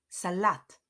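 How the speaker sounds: "Salat" as sa-LAT, falling at the end; weight on the last syllable of "Salat"; stress on second syllable. Shown as a demonstration. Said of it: "the stress falls on the second syllable".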